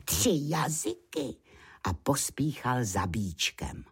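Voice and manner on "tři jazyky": plain reading voice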